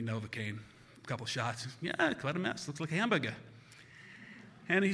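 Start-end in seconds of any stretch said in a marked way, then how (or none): 1.8-3.3 higher-pitched Boston doctor imitation; Boston accent. Doc examines the boy's face, offhand Boston accent. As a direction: size up the damage with dropped Boston R's. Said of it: "he's from Boston".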